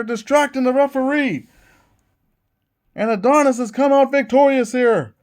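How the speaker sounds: hyped play-by-play call; wrestling commentator. Acted. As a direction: call the match like a ringside announcer. Calls the finish of match.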